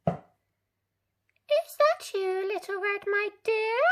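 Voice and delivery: put-on high, slow quavering old lady; high old granny call. A narrator's reading. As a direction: call through the door like a frail grandma. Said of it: "called Granny".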